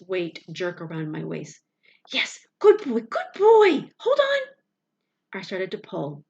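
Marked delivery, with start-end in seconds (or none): 2.1-3.0 relieved whisper. A narrator's reading. Relief as dog bites rope. 3.0-4.4 much higher, louder tearful cheer; crying relief. A narrator's reading. Boy cheering his dog holding the rope, tearful shout.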